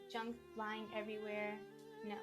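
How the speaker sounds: usual speaking voice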